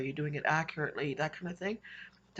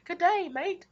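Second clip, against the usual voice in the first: even higher, louder hammed-up Australian "Good day, mate"; mock Aussie greeting; 14 semitones higher, 9 dB louder, slower than usual. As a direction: belt out a cheeky fake Australian hello. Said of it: "I told my sister that. We both laughed".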